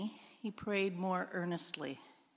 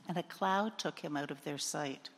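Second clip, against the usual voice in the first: usual speaking voice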